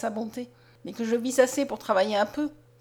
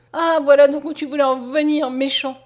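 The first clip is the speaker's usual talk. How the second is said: loud, wounded outburst; sobbing reproach; 3 semitones higher, 8 dB louder than usual. A narrator's reading. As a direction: reproach as if through tears. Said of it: "répondit en sanglotant Mariette".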